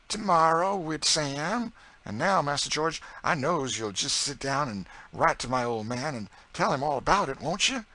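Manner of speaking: high, loud dialect voice bursting with news; excited Southern cook. A narrator's reading. Narrator voices Chloe asking George.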